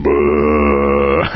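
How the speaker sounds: low, drawn-out buzzing lip noise; a long mock rumble, held low; Howard Stern. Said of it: "I could do the Howard Stern".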